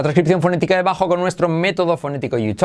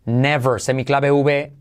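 ordinary talk

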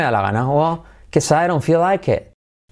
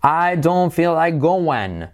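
slow model sentence; 3 semitones higher, slower than usual. Shown as a demonstration.

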